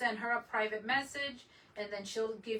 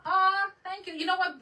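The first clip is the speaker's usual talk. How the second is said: excited thanks; 9 semitones higher, 10 dB louder than usual. Real, not acted. Thanking a viewer on live stream.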